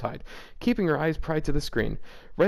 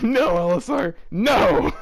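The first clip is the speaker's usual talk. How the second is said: laughing; 5 semitones higher, 5 dB louder, slower than usual. Real, not acted. Still laughing at the text.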